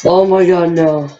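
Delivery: usual speaking voice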